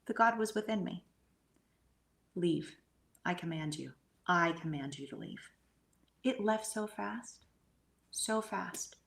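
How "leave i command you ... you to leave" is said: usual speaking voice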